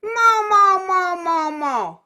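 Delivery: high, loud nasal mo calls. Shown as a demonstration. Teacher modelling nasal mo, loud. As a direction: sing mo into the nose. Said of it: "Try it".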